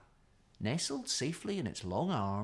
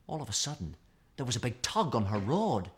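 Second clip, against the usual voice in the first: usual speaking voice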